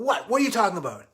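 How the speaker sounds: loud, high challenge; belligerent drunk guy. Retold or imitated. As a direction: answer like a drunk spoiling for a fight. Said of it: "the drunk guy comes and goes".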